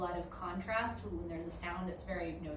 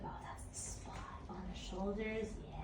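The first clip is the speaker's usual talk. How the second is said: softer dreamy dog voice; the dog sighing with pleasure; contented dog voice; 8 dB quieter than usual. Retold or imitated. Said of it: "He's like".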